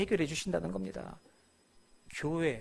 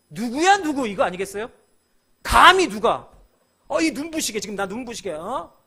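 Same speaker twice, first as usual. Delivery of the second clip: arrogant outrage; 4 semitones higher, 11 dB louder than usual. Retold or imitated. Saul's arrogant reaction.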